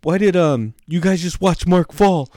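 high-pitched scolding mom imitation; mock angry mom. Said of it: "she's fucking mad".

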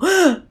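high, loud gasping cry of shock; retold shocked gasp. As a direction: let out a sharp shocked "Ah!". (grito curto e agudo de susto)

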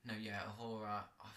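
normal talking voice

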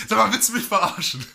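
lauter, hoher Empörungsausbruch; empört. Real, not acted. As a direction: fassungslos anschnauzen. Empört über Eiswürfel im Mund. (laut und empört)

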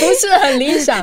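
laughing mimicry. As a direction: echo the words in amused mockery. Listener mimics the teacher's line. (边笑边高声模仿)

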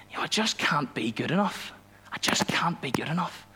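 whispered, ashamed; hushed confession. Retold or imitated. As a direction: barely voice the admission. Man confesses inadequacy.

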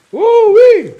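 high loud whooping cry; excited whoop. Real, not acted. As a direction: whoop it out, thrilled. Reacting to question about women.

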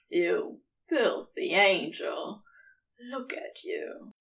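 low, slow taunt fading to a whisper; teasing serpent lover. Acted. Crowley teasing Aziraphale close behind him, low then whispered. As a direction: purr the praise, then drop to a breath.

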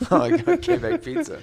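very high falsetto squeal; squeaky high falsetto voice. Pretend. Falsetto mock Quebecer.

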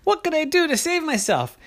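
high, panicky rush rising as it goes; scared. Acted. Kenny thinks the shark will eat him, scared panic. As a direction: work up into a high acted panic. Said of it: "got scared and started to tremble".

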